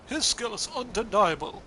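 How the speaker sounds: just state it heroically; plain heroic voice. Acted. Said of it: "Tony, yeah, it's gotta go".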